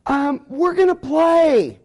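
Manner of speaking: put-on slow, high, drawn-out kid voice; child cheerfully telling a parent the day's plan; mock little kid. Kids answer the parent.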